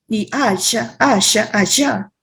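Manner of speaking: allá said as shá, Argentine style; southern Argentine allá with sh; Argentine accent. Shown as a demonstration. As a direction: Rioplatense sh in allá. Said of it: "con el sh".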